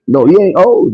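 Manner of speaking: ordinary talk, nothing marked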